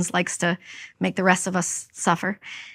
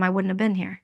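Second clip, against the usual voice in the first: usual speaking voice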